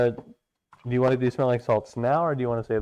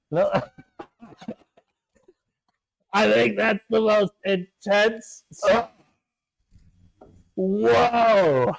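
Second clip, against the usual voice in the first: after smelling salts; 10 semitones higher, 3 dB louder, much slower than usual. Real, not acted.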